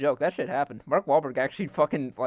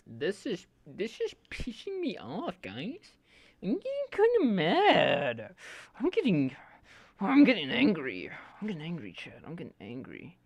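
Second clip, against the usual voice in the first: whiny, higher-pitched mock fuming; goofy mock anger; 4 semitones higher than usual. Streamer venting to chat to stay sane, goofy mock anger. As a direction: play up being angry as a silly bit. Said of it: "saying I'm angry in a goofy way".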